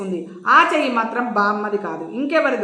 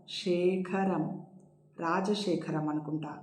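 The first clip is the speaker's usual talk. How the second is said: very soft, slow, slurred and low; indistinctly; 4 semitones lower, 11 dB quieter than usual. A narrator's reading. Drowsy Jayanthi guessing the voice, indistinct and faint. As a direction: barely get the name out. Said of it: "అస్పష్టంగా అంది జయంతి".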